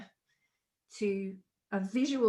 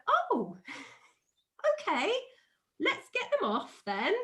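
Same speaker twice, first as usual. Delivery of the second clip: feigned super chilled; 7 semitones higher than usual. Retold or imitated. Mum coaxing wasp-covered child, putting on chilled cheer. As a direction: play it breezy so the child won't react. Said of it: "trying to just be really super chilled about it".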